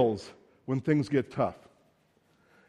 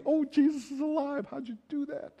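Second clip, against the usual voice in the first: much higher, delighted and amazed; happy Peter; 10 semitones higher, 5 dB quieter than usual. Retold or imitated. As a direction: burst out like a thrilled fisherman. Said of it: "He's really happy".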